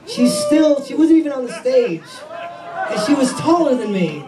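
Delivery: high, giggling incredulity; high amused whine, laughing; laughing disbelief. Real, not acted. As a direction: laugh at being out-heighted. Comic amused by tall woman.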